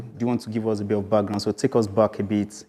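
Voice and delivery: ordinary talk, nothing marked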